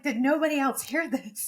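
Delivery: astonished disbelief. Retold or imitated. Crying, looking around amazed.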